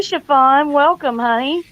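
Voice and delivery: usual speaking voice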